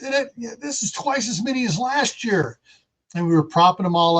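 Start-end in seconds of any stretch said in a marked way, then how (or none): 0.0-2.6 higher, softer voice for the wife; imitating wife. Wife to husband about the pomegranate crop, higher imitated voice. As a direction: quote her lightly, pitched up and quieter. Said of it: "And she goes".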